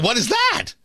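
cracking up into a squeak. Real, not acted. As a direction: voice breaks high again on the question. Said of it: "for the voice crack".

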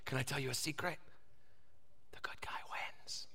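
hushed parent sharing a secret; whisper. Acted. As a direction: drop low and soft into a whisper. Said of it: "I'll whisper".

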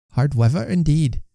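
lightly voiced wife. A narrator's reading. Wife answers in narrated dialogue.

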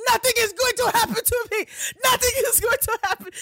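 high, loud panicked wail; mock scared screaming. Friend mimicking the spooked driver, high loud panicked wail. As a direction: shriek it like a terrified man. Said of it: "But the fear".

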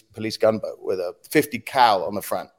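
ordinary talk

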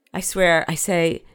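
usual speaking voice

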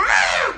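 sudden high horror-film scream; a quick mock scream like in scary movies. Shown as a demonstration. Showing how people scream in horror movies, mock scream. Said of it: "películas de miedo que la gente grita".

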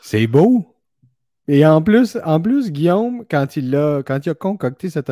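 loud, high thrilled praise; excited. Real, not acted. Host praising Guillaume's artwork, loud and thrilled. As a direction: burst out with delight at the art.

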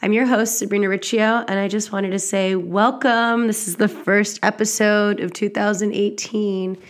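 normal talking voice

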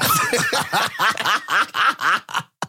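high laugh in repeated bursts; long giggling laugh. Real, not acted. Host laughing about mom hearing the podcast, long laugh. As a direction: keep laughing in rising bursts.

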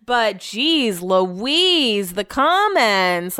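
slow, stretched exasperated sigh; drawn-out exasperation. Real, not acted. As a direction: drag out geez Louise. Drawn out exclamation.

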